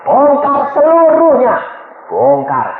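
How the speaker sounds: loud, high, drawn-out urging; perintah tegas. Retold or imitated. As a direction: call out the command at full force. Urges wife to confess everything. (seruan keras mendesak, bernada tinggi)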